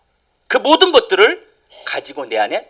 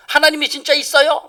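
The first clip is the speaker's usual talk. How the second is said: challenging schoolgirl; 11 semitones higher than usual. Retold or imitated. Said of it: "막 이렇게 막 따져요".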